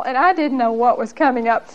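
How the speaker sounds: usual speaking voice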